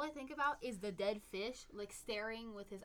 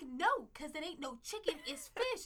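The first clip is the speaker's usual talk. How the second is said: Southern drawl, higher and sassy; country accent; 4 semitones higher than usual. Retold or imitated. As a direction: lay on a Southern twang. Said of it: "I heard a country accent in there".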